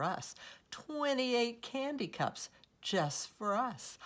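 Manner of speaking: high, loud, exuberant listing; excited cheering-up. A narrator's reading. Girl swinging Della round the room, excitedly cheering her up. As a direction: whoop up each treat to cheer a friend.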